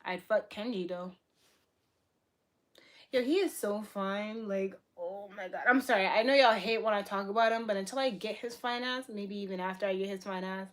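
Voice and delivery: ordinary talk, nothing marked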